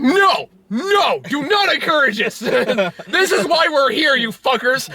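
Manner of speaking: shouting mock friend's outrage; mock outraged shout. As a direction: yell it like a fed-up friend. Mocking Connor's outrage.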